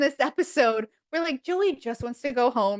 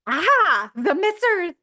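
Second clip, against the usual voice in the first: rising to very high, louder glee; gleeful pun; 7 semitones higher, 5 dB louder, slower than usual. Retold or imitated. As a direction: savour the pun, climbing up. Chandler's punning line, rising high.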